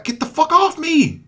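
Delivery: sudden high-pitched yell; annoyed outburst. Real, not acted. Gamer attacked mid-explanation, exasperated yell.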